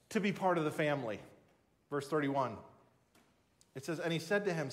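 usual speaking voice

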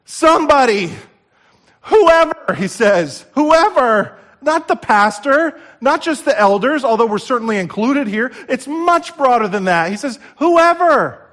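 loud, high preaching shout; preaching at full force. Acted. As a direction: call it out like a revival preacher.

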